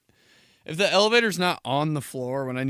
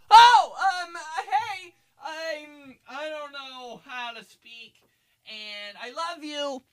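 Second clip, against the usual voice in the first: panicked high voice; 14 semitones higher, slower than usual. Retold or imitated. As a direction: fall apart on the phone.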